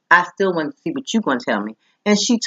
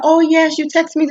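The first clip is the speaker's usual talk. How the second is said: high, bright eager greeting; sweet friendly saleswoman; 12 semitones higher, 3 dB louder than usual. Retold or imitated. Saleswoman greeting Cynthia on the phone, high and eager. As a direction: gush a high, happy hello to a caller. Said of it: "She said".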